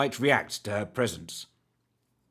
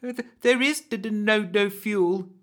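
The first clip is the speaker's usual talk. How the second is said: frightened stammer; 10 semitones higher, 3 dB louder than usual. Acted. Rusty stammers to the hag, scared. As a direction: trip over each word in panic. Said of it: "stammered Rusty".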